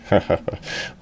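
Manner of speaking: low, soft acted chuckle; a small sly laugh under the breath; little snicker. Crewmates joking among themselves, soft sly chuckle. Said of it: "laughing a little bit".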